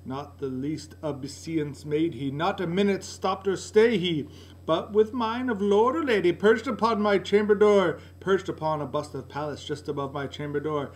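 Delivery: usual speaking voice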